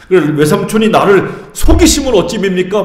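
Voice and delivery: half-acted indignant complaint; mock furious protest. Jacob protesting to his uncle, mildly outraged. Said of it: "막 이렇게 막 노발대발 하잖아요".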